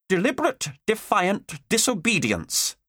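high, slow, every word spat out; building temper. Acted. Teacher working herself into a temper at the pupil, slow fury. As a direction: hammer each word home. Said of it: "working herself into a temper".

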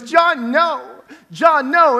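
high, loud pleading John, no; pleading sister. Retold or imitated. Sister begging her brother not to throw the blanket, panicked. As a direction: beg him to stop, panicked.

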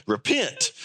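a prophet calling the crowd to repent; preacher crying repent. Retold or imitated. John the Baptist calling sinners to repent.

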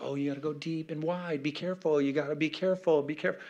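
soft, fretful advice-giver; mock cautious advice. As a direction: imitate a pastor fussing in a hushed, lifted voice. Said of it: "getting lessons about how to talk".

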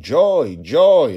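desperate loud calls across a forest; frantic father calling for his lost daughter. A narrator's reading. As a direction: shout her name into the trees, panicking. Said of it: "was looking over the wooden railing and calling".

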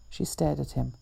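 plain reading voice

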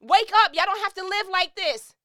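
loud, high, worked-up plea; worked up shout. Real, not acted. Urging viewers to change, shouting worked up. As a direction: yell at them to wake up. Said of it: "'cause I'm worked up".

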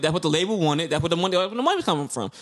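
ordinary talk

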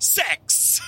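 high, drawn-out backup shout; extra guy's interjection. Retold or imitated. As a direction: imitate the stray background vocalist. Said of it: "It's just the extra guy just".

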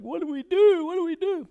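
high, panicked wail of a crowd; hammed-up frightened people; mock panic. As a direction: cry out in exaggerated, high-pitched alarm. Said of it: "fearful and worried and afraid and stressed out".

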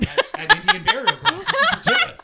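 high, loud laughing squeal; mock outrage. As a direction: shriek it in comic disbelief. Joking outrage about cursed women.

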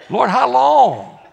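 loud, high, drawn-out mock protest; exclaimed question. Isaiah balks at the Lord's sending, loud mock protest. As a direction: cry it out, stretching the words. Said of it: "this is how I know he's Baptist".

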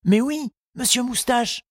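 lightly voiced young boy, pitched high and eager; a boy's light high voice, keen to finish; Tistou's voice. Tistou reassuring his gardening teacher, in a high boyish voice.

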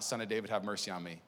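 soft, low, humble cry for mercy; a hushed beggar's plea; quiet plea. Retold or imitated. Blind beggar crying out.

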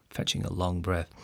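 ordinary delivery, nothing marked

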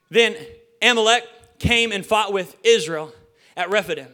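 emphatic, measured public Bible reading; deliberate, projected reading with key words stressed; scripture reading. Acted. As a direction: declaim the verses, pausing between phrases. Reads scripture aloud to congregation.

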